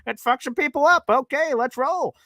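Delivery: rally the troops playfully; mock rallying. Host rallying an angry caller, high and hammed-up. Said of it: "I'm like".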